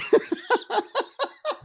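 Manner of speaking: very high, wheezy laughing fit; helpless laughter. Real, not acted. Streamer whose tweet vanished, laughing helplessly. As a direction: lose it in high-pitched laughter.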